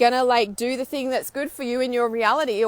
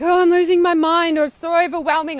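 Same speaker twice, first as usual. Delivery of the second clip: loud, higher burst of overwhelm; loud, higher voice, frantic at losing your mind; 5 semitones higher, 8 dB louder than usual. Retold or imitated. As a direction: blurt it out like you're losing it. Acting overwhelm and rage.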